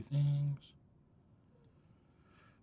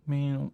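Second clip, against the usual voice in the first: mock meow; 4 semitones higher, 5 dB louder than usual. Meowing back at the cat.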